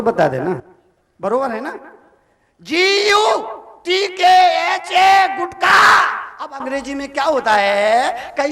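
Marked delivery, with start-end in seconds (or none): 2.6-6.0 loud, high, letter by letter; shouted spelling. Acted. Preacher shouting the spelling to the crowd. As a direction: bang out every letter.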